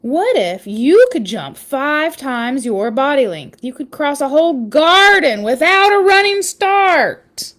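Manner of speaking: lively read-aloud storytelling voice; story narration. Acted. Parent reading a spider book to listeners, storytelling voice.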